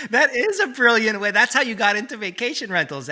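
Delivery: loud, high, laughing amazement; real delighted laughing. Real, not acted. Host reacting to guest's origin story, laughing. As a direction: exclaim through laughter, pitched well up.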